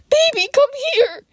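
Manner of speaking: high, loud cry; frantic yell. A narrator's reading. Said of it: "she yelled".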